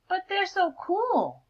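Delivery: high, slow, dreamy admiration; wistful little bird. Acted. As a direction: sigh over how cool they are. Nerdy bird admires the cool birds.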